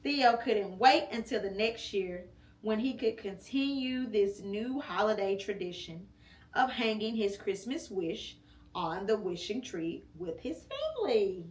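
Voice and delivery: ordinary delivery, nothing marked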